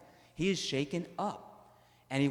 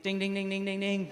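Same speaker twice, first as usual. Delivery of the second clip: bell ding imitation; 7 semitones higher than usual. Imitating the door bell again.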